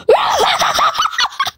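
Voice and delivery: very high stuttering scream-laugh; weird scream. Real, not acted. Player caught while fleeing in game, weird laughing scream. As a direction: shriek that breaks up into laughter. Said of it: "I have the weirdest scream".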